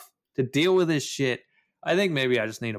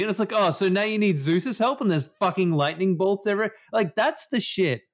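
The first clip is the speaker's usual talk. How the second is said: rant; 8 semitones higher than usual. Real, not acted. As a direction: vent about the game, fired up. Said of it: "Rant energy again".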